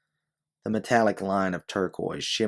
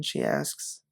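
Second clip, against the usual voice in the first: usual speaking voice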